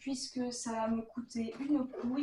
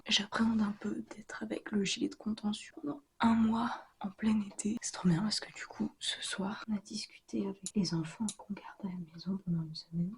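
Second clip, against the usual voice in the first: whispering; 2 semitones lower, 3 dB louder than usual. Real, not acted. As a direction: whisper it so nobody wakes. Keeps whispering at night.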